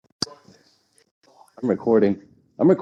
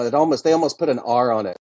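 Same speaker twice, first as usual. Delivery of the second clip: usual speaking voice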